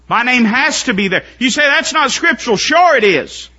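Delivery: high, loud pulpit delivery; fervent pulpit preaching; preaching at full force. Acted. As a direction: preach it hard.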